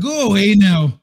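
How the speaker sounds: put-on high, loud Scottish-accented sign-off; Critical Drinker catchphrase. Streamer showing the guest the catchphrase, loud Scottish accent. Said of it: "how was my drinker".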